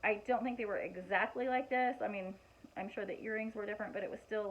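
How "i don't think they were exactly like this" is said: ordinary talk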